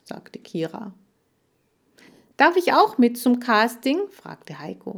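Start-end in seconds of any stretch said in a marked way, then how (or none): 2.4-4.1 high, loud, eager request; eager boy. A narrator's reading. Boy asks to join the casting, eager. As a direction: ask keenly, pitched up. Boy begs to come along.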